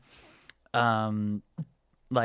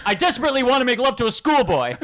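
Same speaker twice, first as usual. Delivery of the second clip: much higher and louder, fast, laughing through it; flustered blurting; 11 semitones higher, 7 dB louder, faster than usual. Retold or imitated. Lloyd botching his love confession, fast and high. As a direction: blurt the mix-up in a rushed high voice. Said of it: "He goes".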